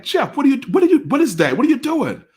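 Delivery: high, loud, incredulous questioning; acted-out exasperated yelp; retold alarmed disbelief. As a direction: stammer the questions way up high, as if aghast. Confronts friend holding potato salad.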